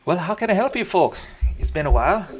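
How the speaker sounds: cheerful high gnome host; high, loud gnome voice. Acted. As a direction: greet the party brightly, like a small gnome. GM voices Tanston greeting party.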